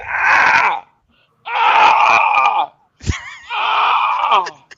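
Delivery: high, loud fake cries of pain; hammed-up yelps as if hurt in a roll; mock pain screams. Retelling fake injury at tryout.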